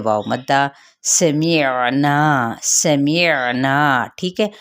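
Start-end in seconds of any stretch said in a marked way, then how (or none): 1.0-2.5 سمعنا stretched very slowly, clear ain, na from the mouth and not the nose; slow tajweed demo. Shown as a demonstration. Teacher models سمعنا for students, very slow, long na without nasal sound. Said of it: "ناک پہ آواز نہیں نکالنی". 2.6-4.1 سمعنا stretched very slowly, clear ain, na from the mouth and not the nose; slow tajweed demo. Shown as a demonstration. Teacher models سمعنا for students, very slow, long na without nasal sound. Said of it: "نا الف مدہ ہے".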